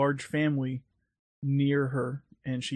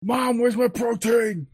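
yelling across the room; 8 semitones higher, 5 dB louder than usual. Retold or imitated. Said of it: "I'm quoting old YouTube videos".

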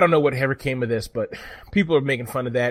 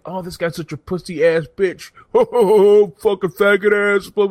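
high, loud, jeering pundit with a laugh; mocking pundit; 8 semitones higher, 8 dB louder than usual. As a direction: jeer like a smug host mocking a guest. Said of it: "The Young Turks are like".

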